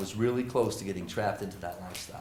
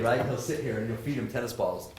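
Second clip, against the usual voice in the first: ordinary delivery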